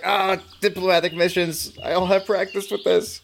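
high, put-on awkward bodyguard voice; mock nervous bodyguard. Bodyguard mocking his own bad diplomacy, high put-on voice. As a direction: play him flustered and out of his depth. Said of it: "being like".

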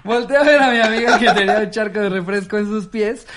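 ordinary delivery

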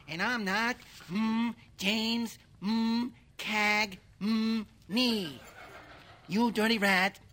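clipped tough-guy gangster; snarly old movie gangster; James Cagney impression. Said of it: "impressionists for hire".

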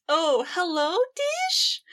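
bright sing-song greeting rising high on Dish; imitating Sarah. Quoting Sarah picking up the phone, high sing-song. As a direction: answer the phone sweetly, lilting up. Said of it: "And she says".

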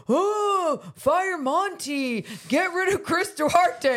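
high, drawn-out mock fan outcry; hammed-up calls for heads to roll; outraged fans. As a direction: imitate overreacting fans, wailing high and slow. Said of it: "and everyone's like".

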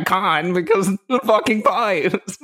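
high, petulant whine; whiny vampire. Pretend. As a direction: complain like a spoiled monster. Vampire ranting about pipes.

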